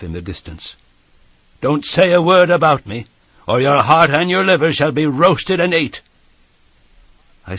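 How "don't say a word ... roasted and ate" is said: loud, high, forceful threat; menacing convict. A narrator's reading. Convict warns boy to tell nobody, loud menace. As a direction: threaten him at full voice.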